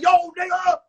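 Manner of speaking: high, loud acted yell; angry shout at a runaway; screaming. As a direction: holler down a hallway after someone. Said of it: "He's screaming down the hallway".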